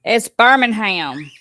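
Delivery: Birmingham said Burmin-ham, the g dropped; Alabama Birmingham. Shown as a demonstration. Reading to viewers, Birmingham the Alabama way. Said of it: "That's the way we say it in Alabama".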